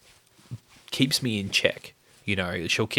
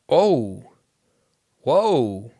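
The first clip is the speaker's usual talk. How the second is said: surprised exclaim; 2 semitones higher, 7 dB louder than usual. Retold or imitated. Retelling his surprise at the gift.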